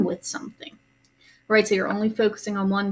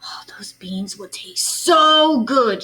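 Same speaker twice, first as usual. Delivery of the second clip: slow whisper, wistful; whispered thought; 3 dB louder, slower than usual. A narrator's reading. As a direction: breathe it as a secret longing. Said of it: "she thought".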